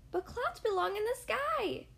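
high, alarmed squirrel voice; alarmed cry. Acted. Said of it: "cried Squirrel".

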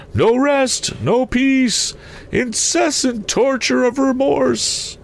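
very high, slow phantom wail; tormented ghost. A narrator's reading. Ghost telling Scrooge of endless travel, tormented high wail. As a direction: draw it out high, as a soul without rest.

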